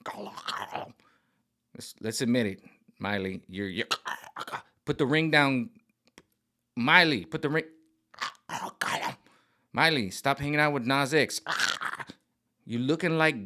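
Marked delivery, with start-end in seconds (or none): 0.0-0.9 whispered Gollum gurgle; whispered Gollum impression. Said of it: "turns from the Princess of Disney into Gollum". 8.5-9.1 whispered Gollum gurgle; whispered Gollum impression. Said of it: "into Gollum".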